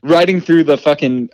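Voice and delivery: normal talking voice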